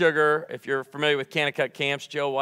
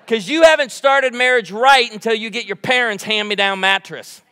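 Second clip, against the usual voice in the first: ordinary talk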